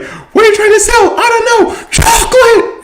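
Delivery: high frantic shouting; hammed-up high, loud yell; yelling cartoon mob. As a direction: shriek it like a frenzied cartoon mob. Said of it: "there's, like, the mob. It's like".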